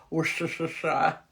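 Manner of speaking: slow American-accent Worcestershire, every syllable spelled out. Shown as a demonstration. Mocking how Americans say the county, in an American accent. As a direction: say Worcestershire as an American tourist would. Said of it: "as Americans would say".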